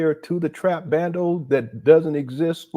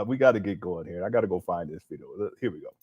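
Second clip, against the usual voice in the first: ordinary talk, nothing marked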